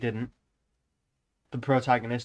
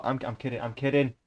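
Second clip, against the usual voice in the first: ordinary delivery, nothing marked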